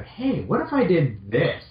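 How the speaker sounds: ordinary delivery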